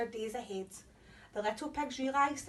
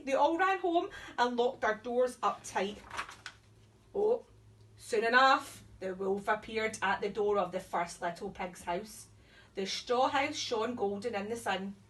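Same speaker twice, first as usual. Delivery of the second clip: usual speaking voice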